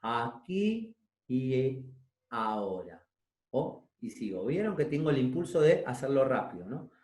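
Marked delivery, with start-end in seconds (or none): 0.0-3.1 much slower. Shown as a demonstration. Voice teacher showing students how to slow right down, very slowly. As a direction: stretch every sound, as slow as you can. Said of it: "Y realéntenlo más".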